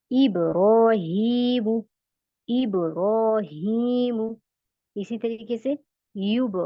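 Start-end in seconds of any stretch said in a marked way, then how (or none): 0.1-4.4 इब्राहीम drawn out very slowly with a jolt on ब; slow with bounce. Shown as a demonstration. Teacher drawing out इब्राहीम for students. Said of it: "इब, सिर्फ बा में आएगा".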